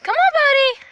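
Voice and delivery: high, loud coaxing; coaxing call. Real, not acted. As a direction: sweet-talk the dog over. Coaxing the dog.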